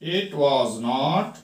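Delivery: very slow, halting, word by word; slow model reading. Shown as a demonstration. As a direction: read it out slowly for the class. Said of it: "teacher reads model sentence slowly".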